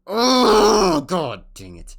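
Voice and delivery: very loud high wail, dropping off; overwhelmed outcry. Real, not acted. Player reacting to a new picture, loud anguished outcry. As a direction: wail it out, then let it fall. Cries out at the game.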